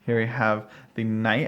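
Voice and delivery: usual speaking voice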